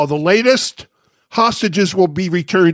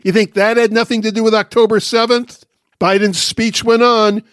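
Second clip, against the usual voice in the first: ordinary talk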